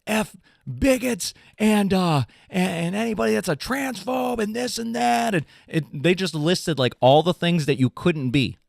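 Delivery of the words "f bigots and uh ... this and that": high sneering voice listing rules; pitched-up preachy punk impression; mocking punks. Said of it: "they were like".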